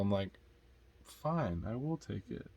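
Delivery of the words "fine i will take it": quiet, grudging retold surrender; reluctant giving in. Buyer retelling giving in to an old lady, quietly reluctant. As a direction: give in softly, mimicking your own reluctance.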